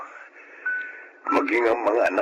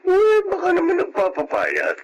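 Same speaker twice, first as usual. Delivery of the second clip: surprised; 4 dB louder than usual. Acted. Surprised guess about Yolanda.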